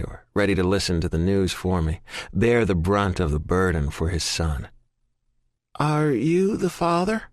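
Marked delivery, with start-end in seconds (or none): 5.8-7.2 slower, higher, soft Southern drawl with a questioning rise; soft Southern accent. A narrator's reading. Nurse repeats her question to Colton, slow soft Southern accent. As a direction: ask again, patient and Southern. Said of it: "the voice asks again".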